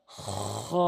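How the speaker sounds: খ rasped quietly from the back of the throat; Arabic kha. Shown as a demonstration. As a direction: scrape খ from deep in the throat. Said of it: "এভাবে".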